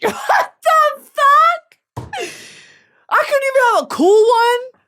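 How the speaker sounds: high, loud, slow squeal of disbelief; laughing outrage. Real, not acted.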